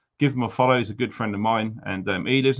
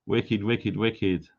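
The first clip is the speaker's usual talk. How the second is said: normal talking voice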